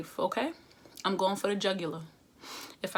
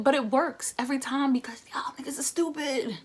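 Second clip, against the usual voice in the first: breathy whispered aside; conspiratorial whisper; 5 semitones higher, 4 dB louder than usual. Real, not acted. Telling the viewers why her trick works, whispered aside. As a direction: lean in and whisper the punchline.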